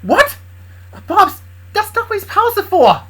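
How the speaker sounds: high, loud, startled character cry; surprised. Acted. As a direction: shoot the pitch up in shock. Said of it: "Kindred looked surprised".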